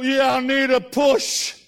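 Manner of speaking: put-on slow, loud slurred drunk voice; drawn-out tipsy plea; drunk man. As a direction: play the drunk at the door, drawn out.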